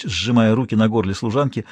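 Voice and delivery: ordinary delivery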